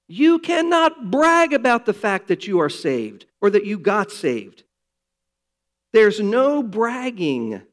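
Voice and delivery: loud, driving sermon delivery; a pastor pressing the point home; full-force preaching. Real, not acted. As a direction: preach it hard from the pulpit.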